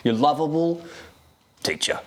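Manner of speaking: lightly put-on Australian teacher, reassuring; a breezy Australian teacher calming a pupil; Australian accent. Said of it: "terrible Australian accent".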